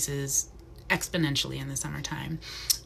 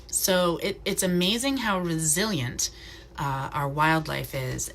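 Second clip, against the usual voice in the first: usual speaking voice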